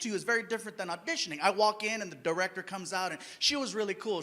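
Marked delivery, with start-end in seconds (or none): none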